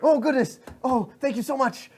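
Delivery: high, loud gushing fanboy; overjoyed. Acted. Guard thanking idol for the honour, overjoyed.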